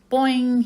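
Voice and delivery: high, loud springy boing; frog boing. Acted. Og answers the hamster, springy boing. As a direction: pop it out like a spring. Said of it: "he replied".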